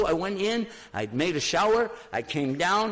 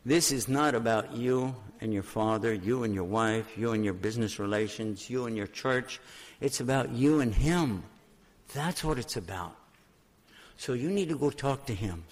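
low, softened, steady advice; measured voice of a patient adviser; retold calm counsel; 4 semitones lower, 3 dB quieter than usual. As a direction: quietly set a worried friend straight. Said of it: "Finally, he said it like this".

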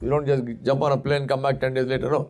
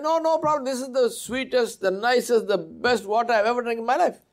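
high, eager praise of the water; an acted governor, lightly delighted; 8 semitones higher than usual. Governor marvels at the water to his men, delighted.

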